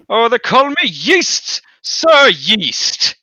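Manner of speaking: high, slow, grand little voice; talking bread voice. Acted. The bread introducing itself in a grand high voice. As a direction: announce yourself like a proud loaf.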